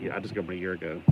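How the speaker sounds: deflate the mock excitement, drop it quietly; unimpressed retort. Vlogger answering imagined newcomers, unimpressed. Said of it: "It's like".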